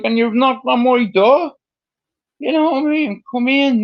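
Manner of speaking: very high-pitched, incredulous protest; high, urgent mock-flustered voice; retold exasperated disbelief. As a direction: act out alarm at an unwelcome caller. Retold reaction to friend on run.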